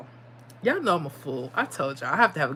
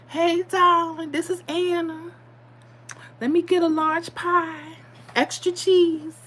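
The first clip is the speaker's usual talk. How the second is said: higher, slower Brooklyn grandma ordering sweetly; grandma on the phone; 10 semitones higher, slower than usual. Retold or imitated. Grandma calls the pizza place to order, in a high slow voice. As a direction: play grandma phoning in her pie order. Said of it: "she would call the pizza place".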